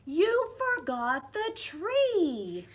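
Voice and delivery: put-on high, slow child's cry; a little one sounding the alarm. Child voice in story.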